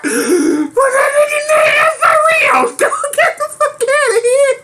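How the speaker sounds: high, squealing words through laughter; real hysterical disbelief; laughing so hard. Real, not acted. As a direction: force the words out while cracking up. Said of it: "'cause I'm laughing so hard".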